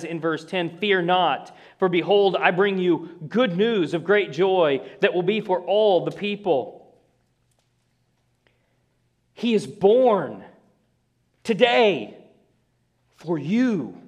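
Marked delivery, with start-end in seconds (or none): 0.8-7.0 raised, proclaiming reading of the angel's words; reading scripture aloud. Acted. Preacher reading the angel's announcement, proclaiming. 9.3-14.0 slow, higher and louder, each phrase set apart; emphatic proclamation. Shown as a demonstration. Preacher to congregation, stressing born and today. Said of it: "They give emphasis to that word".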